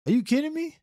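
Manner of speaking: very high, stunned disbelief; can't believe it; are you serious; incredulous. Real, not acted. Disbelief at cop's claims.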